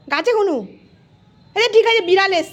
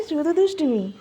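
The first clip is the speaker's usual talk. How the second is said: ordinary talk, nothing marked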